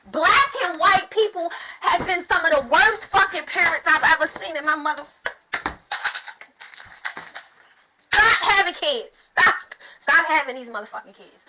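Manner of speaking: shouting, outraged; loud rant. Real, not acted. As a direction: yell it in disgust. Ranting at bad parents.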